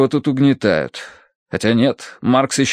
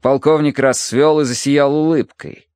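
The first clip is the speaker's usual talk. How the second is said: ordinary delivery, nothing marked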